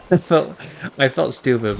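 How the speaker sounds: laughing. Real, not acted. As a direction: laugh as you say it. Laughing while telling.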